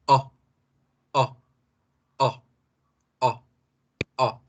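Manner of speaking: O from an open A mouth; short O. Shown as a demonstration. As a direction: open for A but say O. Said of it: "pero en vez de la A decimos O".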